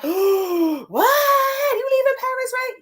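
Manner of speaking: high, slow, wailing mock disbelief; mock shocked viewer. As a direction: play the stunned fan crying out in disbelief. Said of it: "I know you like".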